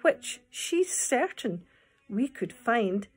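lively storytelling read-aloud; poem reading. Acted. Teacher reading the beetle poem to children.

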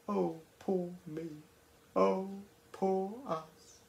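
soft, quiet mock oh poor me; mock pity party. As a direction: lightly mimic self-pity, hushed. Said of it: "a little pity party where they go".

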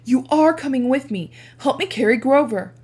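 loud, stubborn insistence; shouting. Acted. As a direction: shout it over the storm. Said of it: "I shouted".

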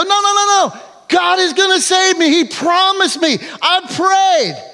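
high, insistent no-no-no; stubborn refusal. Acted. Man in the boat refuses the rescue boat, high and insistent. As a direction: wave them off, sure God will come.

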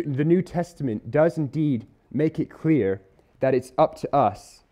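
usual speaking voice

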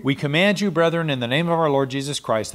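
usual speaking voice

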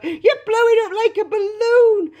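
high, loud cry of horror; screech. A narrator's reading. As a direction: screech like a panicking mother. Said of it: "screeched Missus Beauregard".

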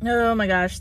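ordinary talk

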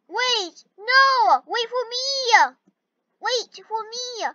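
high, pleading calls; anxious pleading. Real, not acted. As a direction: beg them to wait. Left behind, calling out.

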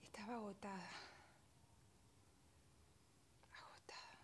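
softly worn out, sinking to a whisper; exhausted, fading. Acted. Mother confessing how exhausted she was, fading to a whisper. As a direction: let the voice drain away into breath.

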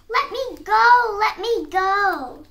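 slow, high, loud pleading; piglet squeal. A narrator's reading. Piglet struggling in the fox's grip, squealing. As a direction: squeal it out, stretching each plea. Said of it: "piglet kicked and squealed".

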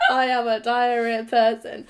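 laughing through the words; breaking up with laughter mid-line. Real, not acted. To her viewers after an embarrassing admission, laughing.

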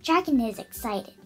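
ordinary delivery

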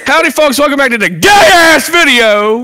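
high, hollered host greeting; over-the-top loud howdy; shouted mock intro. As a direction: holler a hammed-up video opening. Parody of a video intro.

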